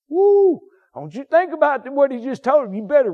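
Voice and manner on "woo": loud high woo shout; sudden loud high whoop; preacher's woo. Real, not acted. Preacher exclaims mid sermon.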